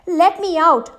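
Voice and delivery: high, loud plea; pleading. Acted. Trapped god pleading with the Brahmin, loud high plea. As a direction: beg to be let out. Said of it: "pleaded the god".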